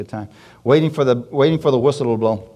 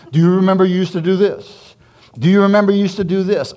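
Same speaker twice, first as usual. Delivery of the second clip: taunting, raised jeering voice; mocking friend; 6 semitones higher, 5 dB louder than usual. Friend taunting the preacher about his past, loud jeering.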